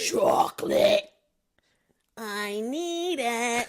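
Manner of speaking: slow, desperate craving voice; whisper-drag it out with longing; craving chocolate guy. Acted. Host voices the chocolate-crazed man, slow. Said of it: "the grandma".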